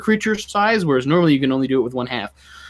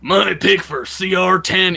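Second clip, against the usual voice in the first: slow, higher boxing-ring introduction; ringside announcer voice; 4 semitones higher than usual. Shown as a demonstration. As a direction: announce it like a fight night. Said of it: "It's just my ringside announcer voice".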